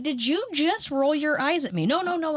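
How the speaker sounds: high, incredulous challenge; indignant. Retold or imitated. Catching the salesman rolling his eyes, high indignant. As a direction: call him out, voice way up.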